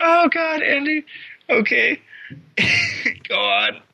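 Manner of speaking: fighting back laughter, pitch up high; giggly, trying to stay straight-faced; stifled giggles. Real, not acted. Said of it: "I'm trying to keep a straight face".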